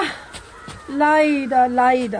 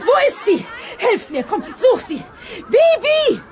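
calling out; 6 semitones higher than usual. Acted. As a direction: shout for the missing girl. Teacher calling for missing Bibi.

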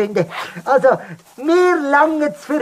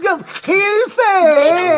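mock cry for help; 6 semitones higher than usual. Globi yells for help.

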